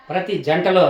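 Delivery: usual speaking voice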